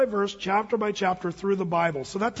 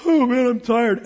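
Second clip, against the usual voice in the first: louder, whining mock of a sleepy grump; whiny tired complaint; 3 semitones higher, 7 dB louder, slower than usual. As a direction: ham up a tired adult's moan. Mocking tired grumpy adults.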